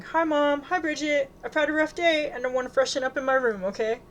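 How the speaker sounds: high, rushed, upset daughter; voice shaking. A narrator's reading. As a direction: keep it together and escape to your room. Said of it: "her voice shaking".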